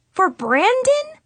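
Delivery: flustered. Acted. Embarrassed at Brandon mention.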